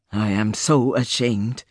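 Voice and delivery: low, slow, shamed confession; ashamed king. Acted. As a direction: speak it in deep shame.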